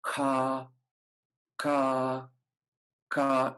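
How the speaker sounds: slow, clean ka attacks; slow ka syllables. Shown as a demonstration. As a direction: slowly practise ka, ka, ka. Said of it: "just slowly practice".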